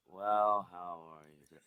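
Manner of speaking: flat, dull, lifeless delivery; monotone voice. Shown as a demonstration. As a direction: drone it out with no energy. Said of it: "comparing it to basically a non-monotone voice".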